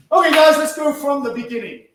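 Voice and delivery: loud instructor's call to restart; calling the class. Real, not acted. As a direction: call out to get the class moving. Calls class to start again.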